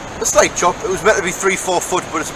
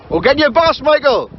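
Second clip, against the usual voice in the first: calling out; 9 semitones higher, 4 dB louder than usual. Real, not acted. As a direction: call it across the beach. Calling out to Michael across beach.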